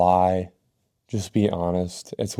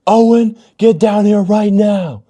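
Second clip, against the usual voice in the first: angry mom yell; 13 semitones higher, 11 dB louder than usual. Retold or imitated. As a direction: yell it up the stairs like a furious mom.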